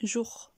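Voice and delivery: JOUR with the sound going down; falling tune. Shown as a demonstration. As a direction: let jour drop like a downward arrow. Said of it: "la voix descend aussi".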